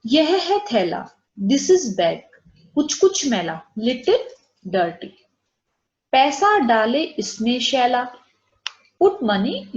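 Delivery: slow teacher's model reading; slow clear read-out. Shown as a demonstration. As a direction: read it slowly so a child can follow. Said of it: "पहला sentence दूसरे से related".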